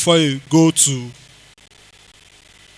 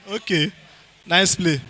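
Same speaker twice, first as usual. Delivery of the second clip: ordinary talk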